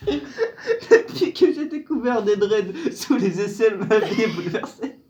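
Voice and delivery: slow, laughing through the words. Real, not acted. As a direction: read it while cracking up. Reading card while laughing.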